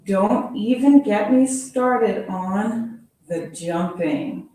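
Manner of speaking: ordinary delivery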